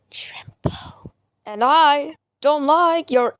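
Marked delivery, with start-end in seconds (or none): 0.1-1.1 hushed whispered name. Acted. Hushed name at end of scolding.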